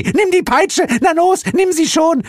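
frantic, very high loud shriek; screaming. A narrator's reading. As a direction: shriek with mounting impatience. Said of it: "schrie sie".

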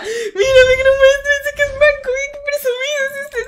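high squealing mock complaint; mock whiny teasing. Streamer teasing her guest about being modest, squealing high. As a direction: whine it up high to tease him.

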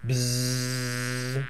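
long, steady low buzz; bee buzz. Pretend. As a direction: drone softly like a swarm of bees. Bees in picture book.

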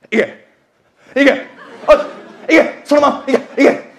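yelling the horse on; retold cheering yell. Punter at the monitor yelling his horse on, loud cheer. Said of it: "めっちゃ声出してて".

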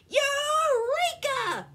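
high, loud, drawn-out exclamation; shouting. A narrator's reading. Stu has an idea, triumphant shout. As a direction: shout it like a sudden brainwave. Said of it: "he shouted".

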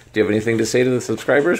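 ordinary delivery

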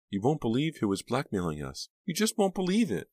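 high-pitched, amazed insistence; incredulous revelation. A narrator's reading. Reva tells Foxy who was blackmailing them, incredulous. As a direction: build to a high, disbelieving finish. Breathless news about a blackmailer.

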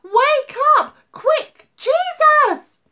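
very high, very loud frantic calls; desperate cries of Wake up; panicked shouting. Acted. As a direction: yell to wake him in terror. Frightened friends wake Jesus.

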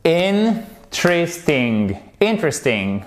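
interesting said IN-tris-ting, first syllable stressed, slow; English pronunciation demo. Shown as a demonstration. Teacher modelling interesting with its dropped E. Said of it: "avec un accent tonique qui est là".

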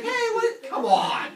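loud, high outraged protest; hammed-up indignant outburst; exasperated wife. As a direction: yell it in disbelief. Voices wife protesting eaten baby.

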